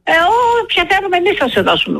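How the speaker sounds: fast, high mock of a curt operator; dismissive operator. Caller imitating the helpline operator brushing her off, fast and high. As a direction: toss it off like a rude clerk, quick and high. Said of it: "μου λέει".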